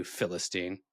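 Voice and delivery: Philistine said FIL-i-steen; stress on first syllable. Shown as a demonstration. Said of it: "when they are using the insult".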